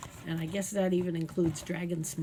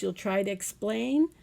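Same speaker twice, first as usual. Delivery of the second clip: plain reading voice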